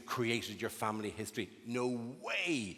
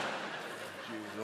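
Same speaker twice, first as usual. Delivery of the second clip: stifled laugh; 4 semitones lower, 8 dB quieter than usual. Real, not acted. Laughs at his own bit.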